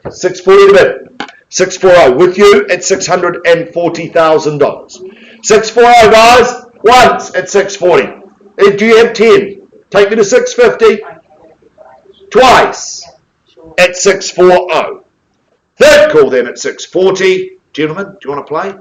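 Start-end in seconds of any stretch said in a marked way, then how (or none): 0.1-5.0 high, loud drawn-out countdown; auctioneer's call. Acted. Auctioneer calling for bids. 5.4-6.1 high, loud bid call. Acted. Auctioneer announcing bid loudly. 6.1-17.6 high, loud drawn-out countdown; auctioneer's call. Acted. Auctioneer calling for bids.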